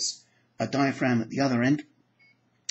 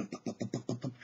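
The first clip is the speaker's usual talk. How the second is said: heartbeat sounds; 2 semitones lower, 12 dB quieter than usual. Retold or imitated. Imitating heartbeats.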